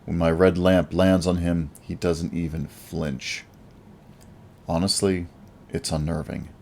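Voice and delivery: plain reading voice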